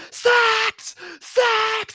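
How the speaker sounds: very high-pitched mock scream; imitated screaming. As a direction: shriek it like an unhinged streamer clip. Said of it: "as loud as she could".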